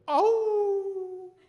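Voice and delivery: long, high, loud "awoo" howl; imitated wild dog howl. Preacher imitating the sound heard in the dark, drawn-out howl. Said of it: "갑자기 이런 소리가 들려요".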